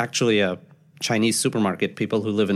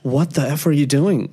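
shocked outburst; 6 semitones higher, 5 dB louder than usual. Retold or imitated. Uncle reacts in shock.

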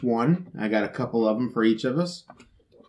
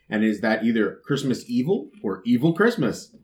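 ordinary talk, nothing marked

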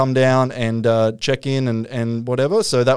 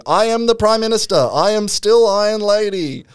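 high, grand mock proclamation; Margaret Thatcher; 8 semitones higher than usual. Medium channeling the Prime Minister at a seance, mock grandeur. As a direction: announce it as a channeled spirit, pitched up. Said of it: "And then he starts going".

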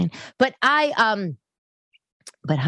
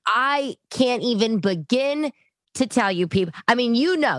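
ordinary talk, nothing marked

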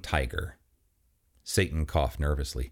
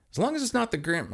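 pitched-up nervous man; high anxious voice; 14 semitones higher, faster than usual. Acted. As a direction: say it rising in dread. Chris dreading the guest.

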